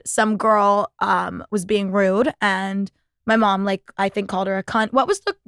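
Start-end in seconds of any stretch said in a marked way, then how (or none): none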